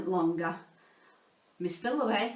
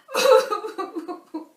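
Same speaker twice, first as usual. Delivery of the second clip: 1 mock blubbing; 11 semitones higher, 9 dB louder than usual. Burst into floods of tears.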